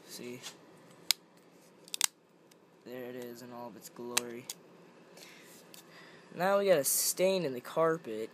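ordinary talk, nothing marked

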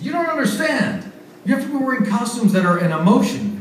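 high, emphatic explaining; insist, losing patience; exasperated insisting. Retold or imitated. Host insisting to German guys.

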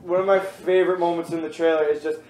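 normal talking voice

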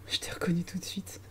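soft, unvoiced whisper. Real, not acted. Streamer whispers to a viewer she recognised. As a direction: whisper it like a secret aside.

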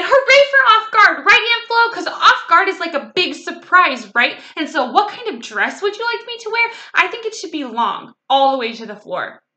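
loud, high cheer easing into eager chatter; excited little girl down the phone; overjoyed child. A narrator's reading. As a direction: gush happily without waiting for answers. Child cheering and gushing to aunt.

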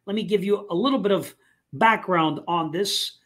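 usual speaking voice

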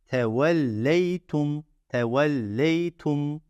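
"Tevelleytum" slowly, syllable by syllable. Shown as a demonstration. Teacher sounding out "Tevelleytum" slowly for the class. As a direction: drag out "Tevelleytum" evenly. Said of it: "En son iki de tek".

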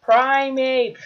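lauter empörter Ausruf; outraged shout. Real, not acted. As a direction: ruf es empört heraus. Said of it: "he's a freaking Primeape".

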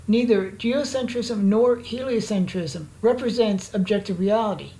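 ordinary talk, nothing marked

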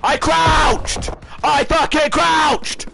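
yelling in outrage that he crouched; furious shout. Real, not acted. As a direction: scream it, furious at the game. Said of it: "Absolutely screaming".

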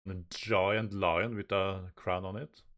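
usual speaking voice

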